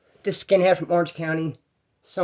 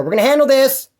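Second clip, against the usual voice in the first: calling under door; 7 semitones higher, 4 dB louder than usual. Retold or imitated. I'm telling him under the door.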